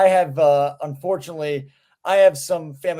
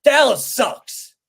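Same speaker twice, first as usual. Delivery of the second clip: retold kid's yell; 7 semitones higher, 6 dB louder, much slower than usual. Kid yelling at uncle.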